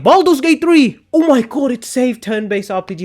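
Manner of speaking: high-pitched imitation of gushing fans; mock fan hype. Streamer mocking fans who credit one game for saving turn-based RPGs, mock hype.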